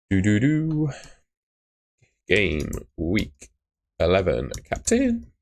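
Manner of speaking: sing-song announcement that cracks into a squeak. Real, not acted. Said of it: "that was a voice crack".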